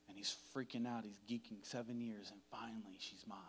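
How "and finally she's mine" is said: softer hushed whisper of longing. Acted. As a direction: breathe it like a private vow. Jacob's private triumphant thought.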